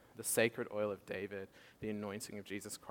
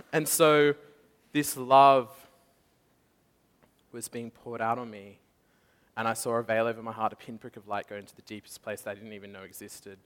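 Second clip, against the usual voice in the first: normal talking voice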